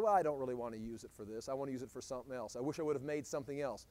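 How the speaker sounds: low, soft and fast, half under the breath; muttered regret. Retold or imitated. A disappointed designer muttering quietly and fast.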